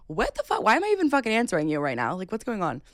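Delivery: high, rising incredulous outburst; exasperated disbelief. Retold or imitated. Storyteller replaying her reply to his weird questions, exasperated.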